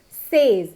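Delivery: SAYS rhyming with "days"; wrong pronunciation. Shown as a demonstration. Teacher shows what not to say, says as "sayz". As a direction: say says as "sayz", long vowel. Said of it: "We never used to say it".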